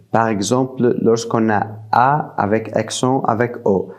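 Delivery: normal talking voice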